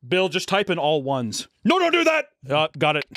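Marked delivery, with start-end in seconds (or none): 1.6-2.3 high-pitched urgent protest; mock alarm. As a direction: cut in with a much higher voice. Acting out a panicked interruption.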